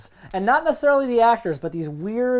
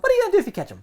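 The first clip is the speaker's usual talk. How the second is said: much higher, disbelieving question; incredulous; 13 semitones higher, much faster than usual. Retold or imitated. Watching a chase scene, incredulous high question. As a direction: ask it baffled at the screen. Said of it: "I'm like".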